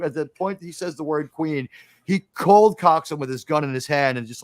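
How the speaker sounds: ordinary delivery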